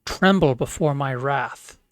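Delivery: booming angry archmage; wizard's wrath. Acted. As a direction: thunder out his name. Wizard proves his identity.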